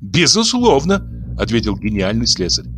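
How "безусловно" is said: higher, slow emphatic Безусловно; certain answer. Acted. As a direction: answer with self-important certainty. Locksmith answers.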